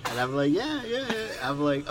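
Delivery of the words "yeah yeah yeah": eager yes. Retold or imitated. Eager agreement acted.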